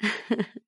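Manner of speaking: short soft scornful laugh; a quiet sneering chuckle; cold laugh. Acted. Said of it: "凤姐冷笑道".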